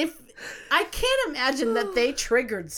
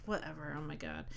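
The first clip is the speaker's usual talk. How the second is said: much softer, lower, fed-up mutter; muttered whatever; 8 semitones lower, 15 dB quieter than usual. Retold or imitated. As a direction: sigh it out under your breath. Retold exasperated inner reaction.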